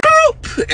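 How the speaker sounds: squeaky high block sound; a sharp high-pitched boop. Retold or imitated.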